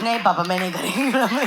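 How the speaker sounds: very fast Hindi through laughter; fast laughing reply. Retold or imitated. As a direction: fire it back quickly, laughing. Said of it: "I'm like".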